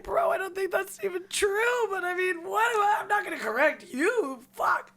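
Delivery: high, incredulous aside; skeptical inner voice. Retold or imitated. Reacting to the crackhead's theory, high incredulous voice. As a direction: doubt him but keep it polite.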